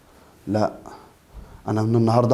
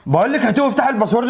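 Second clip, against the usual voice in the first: higher, fast, harsh repeated order; angry demand; 10 semitones higher, faster than usual. Acted. Husband demanding his wife's phone, angry and higher. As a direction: snap the order at her a second time.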